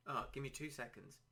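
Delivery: ordinary talk, nothing marked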